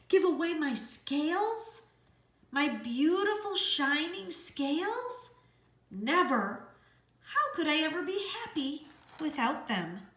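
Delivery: high and loud, aghast; outraged refusal. Acted. Rainbow fish alone after the octopus's advice, outraged refusal. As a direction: refuse with horrified pride.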